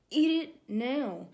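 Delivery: stern inner voice giving an order; commanding inner voice. Retold or imitated. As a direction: insist firmly and slowly.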